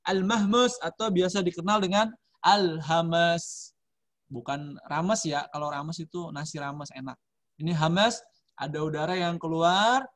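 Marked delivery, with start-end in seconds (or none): none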